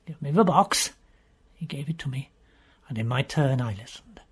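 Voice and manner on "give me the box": plain reading voice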